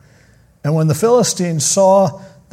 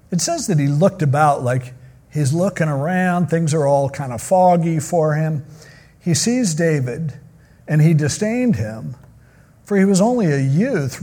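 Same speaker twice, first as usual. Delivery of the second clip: usual speaking voice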